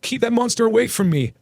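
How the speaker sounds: put-on high, frightened child's cry; a terrified kid pleading, voice way up; scared shaking kid. A narrator's reading. Frightened kid begging the dog owner to keep the dog away. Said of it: "E K's voice is shaking as much as him".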